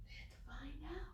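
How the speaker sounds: soft suspenseful whisper. Acted. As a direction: whisper it like a secret. Suspense for children.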